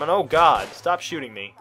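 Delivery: loud alarmed cry. Real, not acted. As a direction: yelp under fire. Under fire in game.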